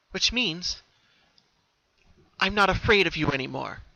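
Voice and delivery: put-on high, lively young pony voice; Pinkie voice. Pinkie, resolved, speaking to herself at the door, high and lively.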